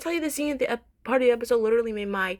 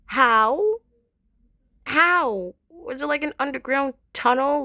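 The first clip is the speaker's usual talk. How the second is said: louder, high, drawn-out disbelief; incredulous; 4 semitones higher, 5 dB louder, slower than usual. Real, not acted. Fan reacting to the outside view of Egypt, incredulous. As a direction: demand it in loud, stunned bafflement. Baffled by the plot twist.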